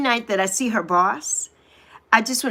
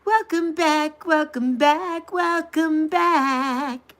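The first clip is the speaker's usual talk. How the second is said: higher, slow, stretched sing-song; slow sing-song welcome back; drawn-out welcome; 6 semitones higher, much slower than usual. Real, not acted. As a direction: draw each welcome out longer.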